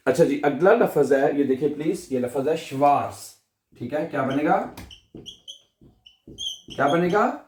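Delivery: usual speaking voice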